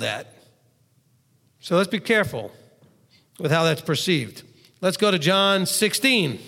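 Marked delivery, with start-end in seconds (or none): none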